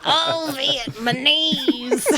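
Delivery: slow, drawn-out Vietnamese with laughter; mocking mispronunciation. Wife teases husband's word, slow laughing mock. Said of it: "And I'm like".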